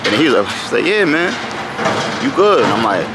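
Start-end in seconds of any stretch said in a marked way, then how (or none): 0.8-2.6 parking attendant. Retold or imitated. Parking attendant reassuring him.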